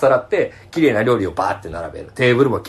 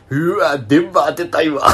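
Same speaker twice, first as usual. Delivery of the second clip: coworker's sleazy remark; 5 semitones higher, slower than usual. Retold or imitated. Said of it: "ってずっと言ってた".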